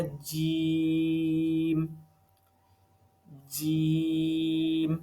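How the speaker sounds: جیم held with a pause, then released with a slight echo; slow, deliberate recitation of جیم; pressed jeem. Shown as a demonstration. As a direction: stick the tongue, pause, then let جیم bounce out. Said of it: "ہم تھوڑا سا وہاں پہ پوز لیتے ہیں".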